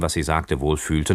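usual speaking voice

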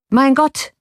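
high, loud cry of alarm. A narrator's reading. Man at the cell bars finds it empty, high loud cry. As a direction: shout it in shock. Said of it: "rief dieser Mensch".

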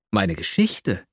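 a light startled rise on the question; startled exclaim. A narrator's reading. Young man asked for his story, a touch startled. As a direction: caught off guard, ask it back lightly. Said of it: "rief ich erschrocken aus".

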